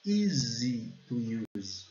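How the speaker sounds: very slow "Easy to use", Y as a strong ee; strong Y sound. Shown as a demonstration. Teacher shows the strong Y, slow pronunciation. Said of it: "o Y é bem característico, bem forte".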